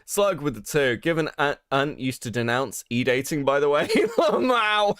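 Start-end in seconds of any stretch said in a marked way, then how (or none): 4.0-5.0 high, laughing wow; cracking up. Real, not acted. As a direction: burst into high-pitched laughter. Cracks up at the chat message.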